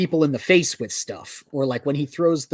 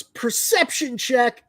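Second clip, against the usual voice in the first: slow, high, nerdy delivery; like a nerd; 8 semitones higher, much slower than usual. Retold or imitated. Mocking a comic character who quotes D and D, slow and nerdy. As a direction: drag out the game phrase like a proud dork. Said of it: "like a nerd".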